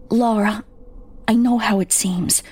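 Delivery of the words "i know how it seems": usual speaking voice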